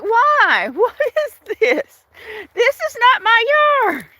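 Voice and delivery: high, slow, baffled cat voice, lightly voiced; puzzled by fallen branches in his yard; confused cat voice. Owner voicing her confused cat at the branches, high and baffled. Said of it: "He's like".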